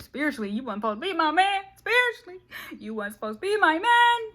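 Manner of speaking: mock indignant cry; rising exclamation. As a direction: build from firm to a high loud cry. Woman telling off the man.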